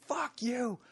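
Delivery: very high, strangled mock scream; retold scream. Furious dude screaming up at the comic, retold scream. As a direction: imitate a furious heckler, screaming under your breath. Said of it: "he's screaming, he's going".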